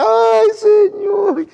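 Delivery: high, loud, long-drawn cry; imitated worship wail. Congregant crying out amid the singing, imitated high wail. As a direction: mimic a sudden drawn-out wail to God.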